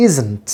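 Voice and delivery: "isn't" with a buzzed Z and a falling end; careful pronunciation. Shown as a demonstration. Teacher repeats the word for learners, "isn't" carefully pronounced. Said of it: "I am telling again".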